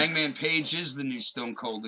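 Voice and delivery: ordinary talk, nothing marked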